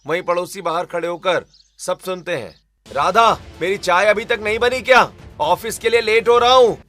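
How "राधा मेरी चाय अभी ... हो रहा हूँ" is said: loud, high impatient yell; angry shouting. Acted. Husband late for office demanding tea, angry shout. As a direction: holler irritably for service. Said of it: "सूरज का गुस्सा कम होता है".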